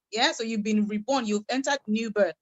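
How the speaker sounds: usual speaking voice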